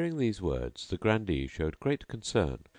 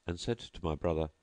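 plain reading voice

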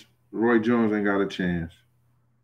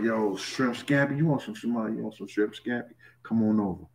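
smooth seductive invite; 9 dB quieter than usual.

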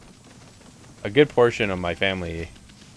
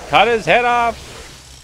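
slow, drawn-out high shout; 8 dB louder, much slower than usual. Pretend. Player yelling at the game fight, long high shout. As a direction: yell it high, stretching the words.

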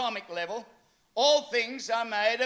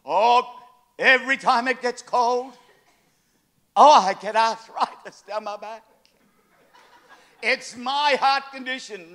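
mock moaning; 8 dB louder than usual. Mimicking complainers.

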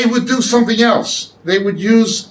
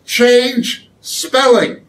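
change spelling said slowly, spelling pressed down; slow teacher repeat; slow emphatic repeat; 2 semitones higher, much slower than usual. Shown as a demonstration. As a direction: drill the point home slowly. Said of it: "you would also change spelling".

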